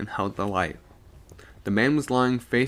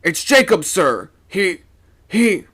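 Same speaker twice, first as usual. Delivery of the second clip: higher, louder halting words; mate stammering the bad news; shaken; 10 semitones higher, 9 dB louder than usual. A narrator's reading. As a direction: falter with fright. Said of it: "said the first mate in jerks".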